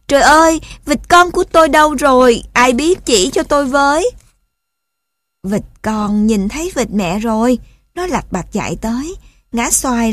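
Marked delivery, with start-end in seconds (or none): 0.1-4.1 high, loud, panicked outcry; frantic mother duck. A narrator's reading. Mother duck searching for her lost duckling, frantic cry. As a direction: cry out desperately for help. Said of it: "rồi bỗng cuốn lên mà chạy".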